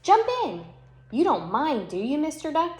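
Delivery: high, loud cow voice; bouncy cow calling to duck; cheery cow. Acted. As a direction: boom out the invitation. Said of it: "said Cow".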